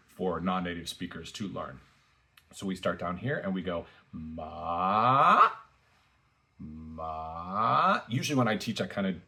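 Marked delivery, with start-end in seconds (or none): 4.1-5.5 long rising MA, like running uphill; second tone. Shown as a demonstration. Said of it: "like we're running up a hill". 6.6-8.0 long rising MA, like running uphill; second tone. Shown as a demonstration. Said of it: "like we're running up a hill".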